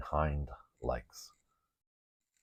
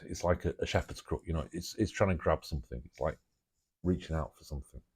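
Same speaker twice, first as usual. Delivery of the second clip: ordinary talk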